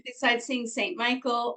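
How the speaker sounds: normal talking voice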